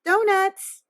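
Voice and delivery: a high, loud eureka cry; boy shouts the answer; excited shout. Acted. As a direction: blurt out the idea. Boy remembering donuts.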